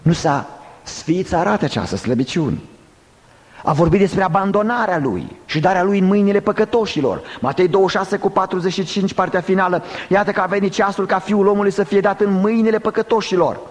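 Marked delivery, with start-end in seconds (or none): 3.6-13.6 high, urgent preacher's declamation; forceful preaching. Real, not acted. Preacher citing Matei to the congregation, forceful preaching. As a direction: preach it on a sustained high pitch.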